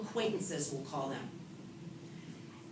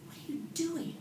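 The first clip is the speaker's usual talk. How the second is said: self-talk; 9 semitones higher than usual. Retold or imitated. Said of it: "and I said".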